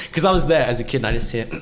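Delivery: ordinary talk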